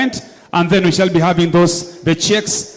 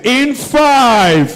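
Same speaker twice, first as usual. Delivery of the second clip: building countdown shout; 4 semitones higher, much slower than usual. Real, not acted. Countdown to winner announcement.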